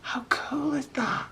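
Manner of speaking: hushed high whisper of amazement; whispered wonder. Real, not acted. Artist admiring her finished crayon art, hushed whisper. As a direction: breathe it out in wonder.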